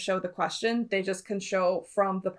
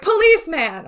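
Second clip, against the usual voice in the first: loud, high imitation of a kid's eager answer; 8 semitones higher, 10 dB louder than usual. Host mimicking a singer's childish dream job, mock-eager.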